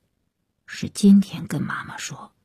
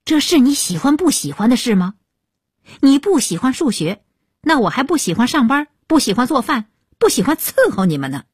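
fast, higher-pitched indignant tirade; angrier; 6 semitones higher, faster than usual. A narrator's reading. Mother fires back at daughter who dislikes maths, angry. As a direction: rattle off the complaints in rising exasperation. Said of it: "他妈就更火了".

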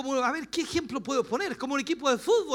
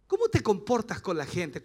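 ordinary talk, nothing marked

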